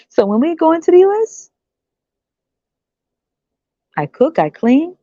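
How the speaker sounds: high excited put-on voice; eager woman. Woman asking the man when they go to the US, high eager voice. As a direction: ask sweetly, pushing the deal.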